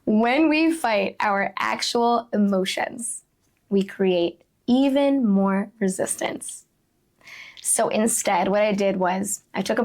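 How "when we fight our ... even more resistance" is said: slow emphatic repeat. Real, not acted. Speaker repeating the key point to listeners, slow and deliberate. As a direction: let each phrase land slowly.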